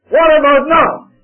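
high, loud preacher's shout; shouted challenge. Acted. The Lord confronts Elijah about the present, shouting. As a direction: fire the question out at full volume.

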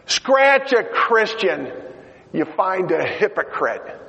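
high, slow mocking jab; sneering lady. Lady in line mocking Fred, high and slow. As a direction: sneer at the believer.

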